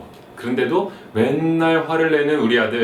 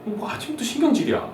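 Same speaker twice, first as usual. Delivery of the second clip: grumbling mom; 10 semitones higher than usual. Acted.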